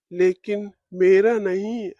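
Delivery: usual speaking voice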